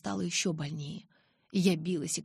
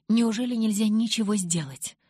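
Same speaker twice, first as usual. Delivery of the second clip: louder, higher anxious question; agitated; 5 semitones higher, 6 dB louder than usual. A narrator's reading. Asking whether anything can be done, agitated. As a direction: demand help in agitation. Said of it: "потом взволнованно спросил".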